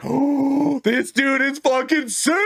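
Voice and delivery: high, drawn-out gushing; awed excitement. Retold or imitated. Player retelling his awe at the boss's smackdown, thrilled.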